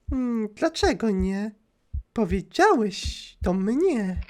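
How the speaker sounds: high, soft, slow sinister woman on the phone; creepy caller voice. Acted. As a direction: purr it like a creepy stalker. Głos stalkerki. (wysoki, cichy, złowieszczy głos dzwoniącej)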